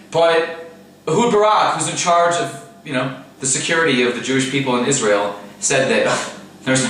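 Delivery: ordinary delivery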